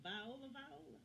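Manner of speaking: high-pitched incredulous double-check; astonished. Real, not acted. Interviewer astonished at the name.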